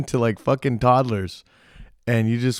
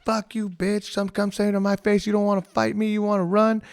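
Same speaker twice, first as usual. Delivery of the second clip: shrill, mocking imitation of a girl picking a fight; mock taunting yell; 10 semitones higher than usual. Girl in the alley daring the ladies to fight, high mock taunt. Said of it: "just screaming".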